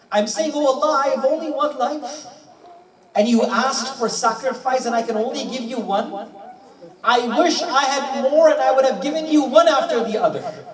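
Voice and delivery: storyteller voicing a fervent prayer; impassioned retold plea. Acted tearful plea to Allah.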